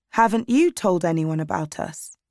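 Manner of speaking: usual speaking voice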